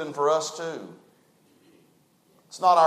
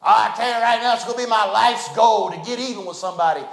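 vengeful voice; 4 semitones higher, 4 dB louder than usual. Pretend. Imagined vengeful listener quoted.

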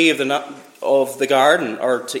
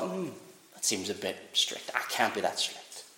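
soft murmured inner doubt, far quieter; quiet inner thought; 6 semitones lower, 13 dB quieter than usual. Retold or imitated. Preacher voices the listener's private doubting thought, very soft. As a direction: mutter it to yourself. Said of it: "that little thought".

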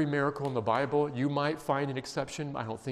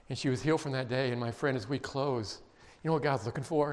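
normal talking voice

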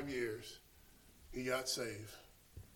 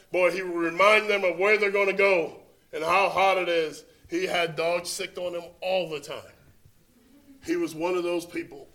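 loud, rising sermon delivery; full-force preaching; 4 semitones higher, 15 dB louder than usual. Acted. Preacher telling of his zealous friend, full-force preaching. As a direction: preach it hard to the congregation.

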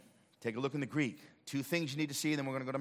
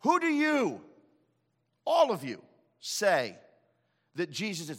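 emphatic question; 4 semitones higher, 7 dB louder than usual. Acted. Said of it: "be like me saying".

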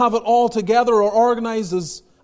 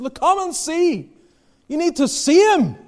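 urgent invitation; 8 semitones higher than usual. Acted. Philip voiced inviting Nathanael.

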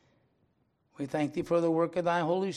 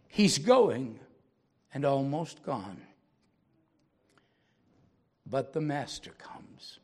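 solemn poem reading; 6 dB louder, slower than usual. A narrator's reading. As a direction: draw the lesson gently to the listeners. Pastor recites poem aloud.

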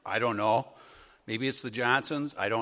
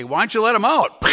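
high, loud triumphant yell; shouted punchline; 9 semitones higher, 12 dB louder than usual. Retold or imitated. Kid yelling gag at grocery clerk, loud high yell.

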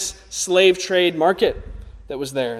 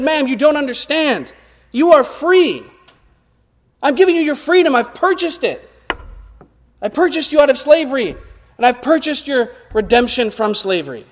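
higher, urgent pleading nobleman; earnest nobleman; 7 semitones higher, 3 dB louder than usual. Retold or imitated. Nobleman pleads with the freed woman, urgent and higher. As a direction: plead with her to understand she is free.

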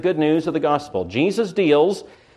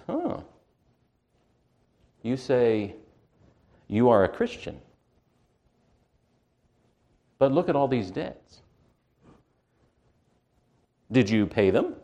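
mocking Satan; 2 semitones lower, 5 dB quieter than usual. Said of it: "Satan comes to us and says".

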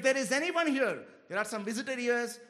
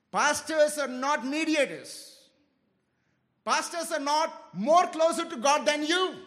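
louder, slow, hammered denial; emphatic preaching; 4 semitones higher, 6 dB louder than usual. Acted. Preacher correcting the church about pastors, emphatic. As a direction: pound each word like a rebuke.

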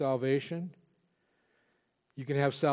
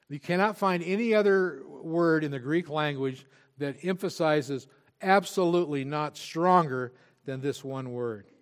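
ordinary talk, nothing marked

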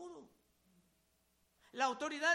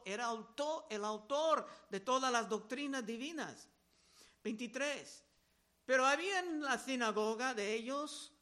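ordinary delivery

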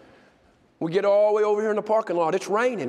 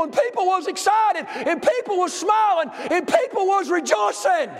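fervent preaching; 10 semitones higher than usual. Acted. Preacher shouts her parking lot prayer to congregation, full-force preaching.

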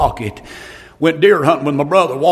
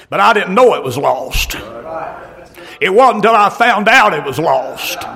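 usual speaking voice

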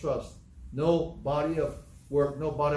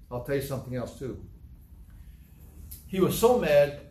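ordinary talk

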